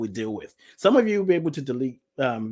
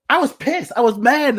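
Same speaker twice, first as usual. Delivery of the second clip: high, loud, worked-up retelling; worked up, voice raised; heated emphasis; 10 semitones higher, 7 dB louder than usual. Real, not acted. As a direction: punch out the anger emphatically. Recalls being angry.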